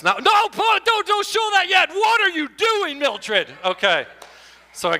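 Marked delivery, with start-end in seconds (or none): 0.0-3.5 high, loud, slow panicked protest; high loud mock panic; alarmed protest. Real, not acted. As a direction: stop her before she spoils it. Telling off Millie for spoiling.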